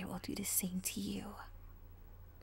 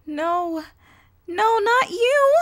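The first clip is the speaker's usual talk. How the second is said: rising, much higher and louder terrified cry; panicked denial; 13 semitones higher, 19 dB louder, much slower than usual. Acted. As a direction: plead in horror as she appears. Recognises her dark double.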